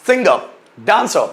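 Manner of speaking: सिंगर, डांसर said slowly as "sing-guh, daan-suh"; silent final R. Shown as a demonstration. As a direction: drop the last R in सिंगर and डांसर, word by word. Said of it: "उसको silent बना दिया जाता है".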